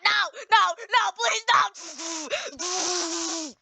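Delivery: high, frantic begging; panicked pleading. Pretend. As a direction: plead for your life as you crash, hammed-up. Crashing, pleading in game.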